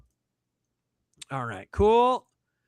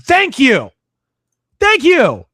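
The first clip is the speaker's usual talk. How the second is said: loud thank you; 7 semitones higher, 10 dB louder, slower than usual. Real, not acted. Loud thanks to chat comment.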